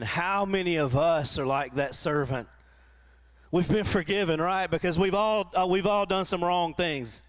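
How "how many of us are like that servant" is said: ordinary talk, nothing marked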